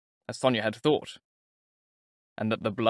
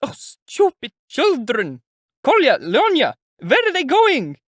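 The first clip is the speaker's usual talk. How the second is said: high, loud, drawn-out despair; desperate mother, high, loud and slow; panicked cry; 8 dB louder, slower than usual. A narrator's reading. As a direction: wail after the fleeing children. Mother sees children run.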